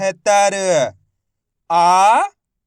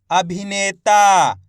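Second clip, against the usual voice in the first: slow blended reading; 4 dB louder, much slower than usual. Shown as a demonstration. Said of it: "तो मिलके बन जाएगा".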